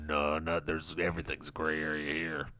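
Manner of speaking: very low, soft guy voice; mocked man's voice. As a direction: brush it off in a low, dull murmur. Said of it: "And he's like".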